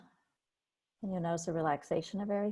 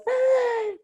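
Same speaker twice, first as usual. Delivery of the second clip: very high loud yelp of alarm; panicked cry; 9 dB louder than usual. Retold or imitated. The freaking-out body, a very high loud panicked yelp. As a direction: let out a sudden shriek of fright.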